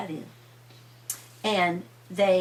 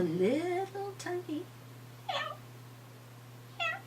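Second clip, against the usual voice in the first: tiny meow; 10 semitones higher, 11 dB quieter than usual. A narrator's reading. Tiny kitten heard.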